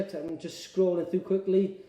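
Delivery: ordinary delivery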